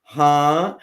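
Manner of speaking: loud, startled questioning huh; retold blurt of disbelief; taken aback. Builder recounting her reaction to a thirty-day deadline, taken aback.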